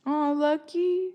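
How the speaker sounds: high, slow cooing over a cute name. Real, not acted. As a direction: coo it like talking to a puppy. Cooing over chat's dog name.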